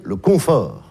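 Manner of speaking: slow "confort", leaning on CON; stress on first syllable. Shown as a demonstration. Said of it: "la première syllabe est très claire".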